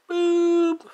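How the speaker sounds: high, drawn-out "boop"; loud, high-pitched boop as a sound effect; playful boop. Real, not acted. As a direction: pop out a silly high boop. Playful sound while swivelling part.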